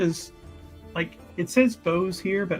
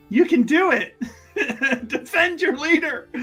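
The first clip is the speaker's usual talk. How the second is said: high, laughing rally cry; higher-pitched, hammed-up encouragement; playful cheering on; 9 semitones higher, 3 dB louder than usual. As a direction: egg them on, giggling through it. Player cheers on the cult students.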